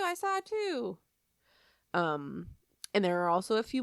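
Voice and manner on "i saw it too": put-on high child voice repeating, level dropping back; a second kid copying the first; mock kid echoing. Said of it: "everybody else going".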